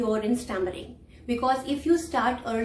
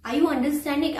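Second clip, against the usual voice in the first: normal talking voice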